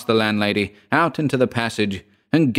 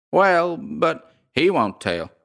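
slow, higher landlady drawl; long folksy drawl; 8 semitones higher, slower than usual. A narrator's reading. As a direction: stretch each word, pitched up. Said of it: "she drawled out".

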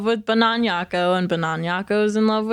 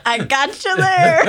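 laughing through the words, slowly; teasing chuckle; 8 semitones higher, 5 dB louder than usual. Real, not acted. Teasing after a joke.

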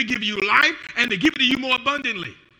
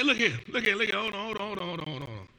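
softer and lower, fading to nothing; fading hold on; 5 semitones lower, 10 dB quieter, faster than usual. Acted. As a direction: trail off muttering hold on. [name] stalling, voice fading away.

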